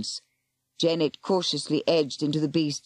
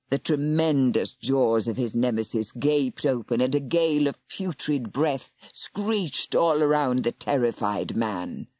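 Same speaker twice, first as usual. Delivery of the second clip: ordinary delivery, nothing marked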